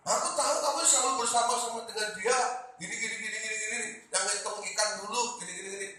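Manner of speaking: high, rapid accusing patter; insistent accuser. Acted. Bystander telling Peter he was with Jesus, insistent accusing. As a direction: press the accusation quickly, pitched high. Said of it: "Kamu pengikut Kristus kan?".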